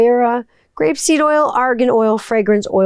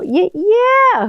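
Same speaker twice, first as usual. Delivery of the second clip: excited squeal; 12 semitones higher than usual. Real, not acted. Excited squeal over turtle product.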